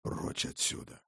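urgent whisper. Acted. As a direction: hiss it low and urgent. Father urges escape in secret.

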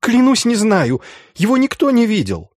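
very high, frightened plea; feverish fear. A narrator's reading. Schulz swears to inquisitors nobody has seen him, high feverish fear. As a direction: swear it desperately in a high pitch. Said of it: "заговорил быстро, лихорадочно, словно боялся".

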